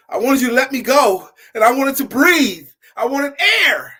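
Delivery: put-on high, loud, desperate child; urgent boy. Boy answering the old man, high loud desperation. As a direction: plead it like you still need air.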